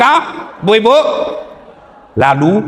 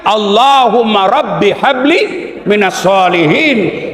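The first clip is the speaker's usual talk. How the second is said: recited prayer; 6 semitones higher, slower than usual. Acted.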